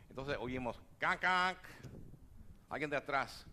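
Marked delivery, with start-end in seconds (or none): 1.0-1.6 imitated car horn honks. Imitating car horns at traffic light.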